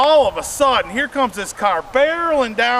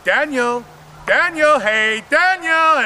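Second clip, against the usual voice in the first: calling name; 6 semitones higher, slower than usual. Retold or imitated. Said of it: "she's like".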